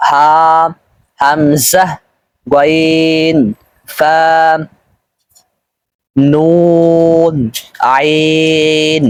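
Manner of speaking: "গইন, নুন, আইন" held long on a level tone; drawn-out letter recitation. Shown as a demonstration. Teacher reading the last line, "হা" to "আইন" drawn out. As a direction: stretch each name slowly, longest on "নুন" and "আইন". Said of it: "টান সহকারে আয়ত্ত করতে হবে".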